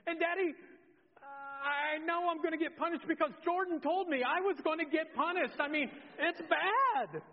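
high, loud, hammed-up wail; mock-sobbing confession building to a long last wail; imitated crying child. Daughter dreading punishment as her sister warned, mock tearful wailing. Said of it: "and she's crying".

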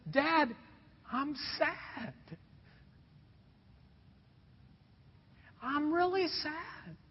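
put-on soft, slow retelling of a teen's sad plea; tearful. Pastor retelling telling his dad he's sad, quietly tearful. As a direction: let the voice go small and hurt. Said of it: "I broke down and started crying".

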